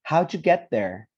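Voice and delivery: "How'd you get there?" run together as "howchu get there"; teacher modelling "How'd you" as one linked "howju"; pronunciation model. Shown as a demonstration. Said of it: "let's pronounce it together".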